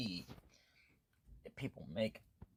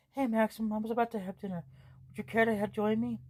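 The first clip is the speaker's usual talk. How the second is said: higher, louder bright hostess voice; cheerful girl; 8 semitones higher, 6 dB louder than usual. Acted. Girl inviting Maxim to dinner, higher and louder.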